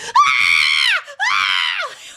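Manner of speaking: high shrill screams, imitating terrified kids; mock child screams. Mimicking kids screaming in the theater, high mock screams. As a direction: ham up the children's shrieks.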